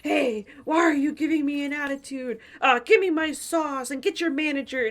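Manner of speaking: pushy customer demanding a manager, loud; confrontational customer. Pretend. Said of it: "I didn't wanna be like".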